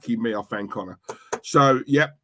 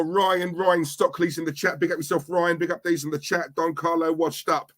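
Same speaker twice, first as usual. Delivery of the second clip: usual speaking voice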